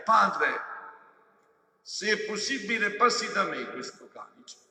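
loud, anguished cry to the Father. Acted. Jesus in the garden crying out to the Father, loud anguished plea. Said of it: "terrore e spavento che gridai".

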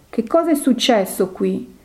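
plain reading voice

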